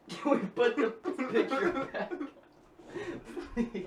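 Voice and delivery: high, laughing plea. Real, not acted. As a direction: beg while still laughing. Laughing fit continues.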